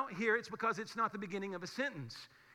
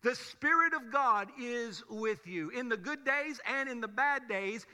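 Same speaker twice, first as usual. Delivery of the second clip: ordinary delivery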